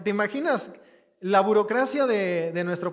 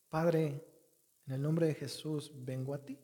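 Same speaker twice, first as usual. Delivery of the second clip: oración suave; 5 semitones lower, 8 dB quieter than usual. Shown as a demonstration. Ejemplo de oración confiada.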